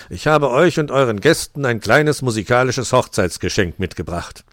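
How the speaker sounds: loud, changed jolly Santa voice; Santa speaking. Acted. Santa presenting a musical gift to the guests, loud and jolly. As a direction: announce the gift like a proud Santa.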